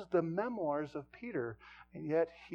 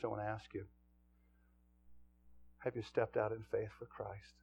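ordinary talk

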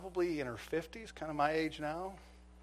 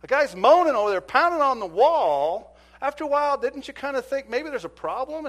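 loud, much higher, outraged rebuke; beside myself; 10 semitones higher, 11 dB louder than usual. Retold or imitated. Officer scolding the neighbor, loud and outraged. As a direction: let the outrage spill over. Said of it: "aside myself".

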